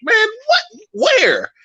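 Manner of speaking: hammed-up wide-eyed tourist voice; mock naive newcomer. Retelling his first California visit, high amazed mock voice. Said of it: "I was like".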